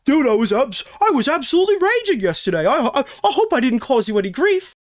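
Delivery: high, sneering, smug voice; biased mock reading. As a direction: read it as mockingly as possible. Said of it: "you read so horribly".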